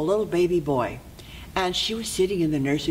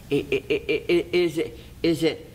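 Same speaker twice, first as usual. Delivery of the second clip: acted stutter, restarting the question; nervous stammer. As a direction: stall and stumble into a guess. Queen stammers while guessing.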